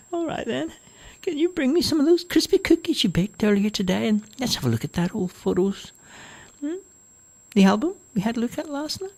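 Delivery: put-on high, whiny old woman; a fussy mother grumbles about the noise, high; complaining old mother. Mother asks for cookies.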